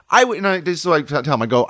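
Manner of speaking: normal talking voice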